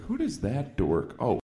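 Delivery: ordinary delivery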